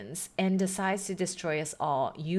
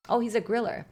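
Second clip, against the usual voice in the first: ordinary delivery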